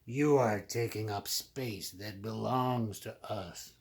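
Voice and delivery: lightly voiced menacing snake; a hint of a big snake's threat. A narrator's reading. Big snake ordering the small pig away. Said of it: "says a big snake".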